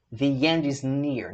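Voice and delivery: "The end" slow, thee with a Y glide; thee end slowly. Shown as a demonstration. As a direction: read "the end is near" with thee. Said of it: "Vamos ler comigo".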